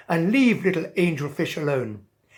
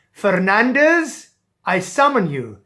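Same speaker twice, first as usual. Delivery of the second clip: royal summons; 4 semitones higher, 9 dB louder than usual. Acted. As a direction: call him grandly. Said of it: "Then he said".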